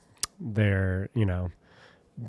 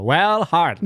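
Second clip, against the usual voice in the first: infomercial; 6 semitones higher, 5 dB louder, slower than usual. Shown as a demonstration. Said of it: "You gotta say it like a, like a infomercial".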